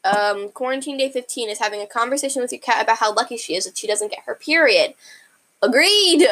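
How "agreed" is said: a sudden loud, high "Agreed!"; excited agreement. Real, not acted. Reacting to the tweet with a loud, excited cheer. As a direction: blurt it out, delighted.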